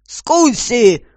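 high, loud summoning yell; loud shout. A narrator's reading. Commissioner summoning his subordinate, loud shout. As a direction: shout for the assistant. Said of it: "громко крикнул он".

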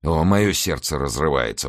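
ordinary delivery, nothing marked

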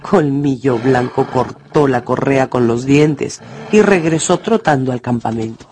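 normal talking voice

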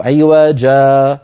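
عوجا with the final alif drawn out long and slow; stopping pronunciation. Shown as a demonstration. Teacher showing how to say عوجا at a pause. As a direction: stretch the ending of عوجا into a long a. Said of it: "فنقول".

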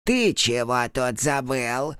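put-on slow, unexpectedly calm old witch; peaceable. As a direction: ask it calmly, no threat. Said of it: "спросила она неожиданно миролюбиво". (миролюбивый тон)

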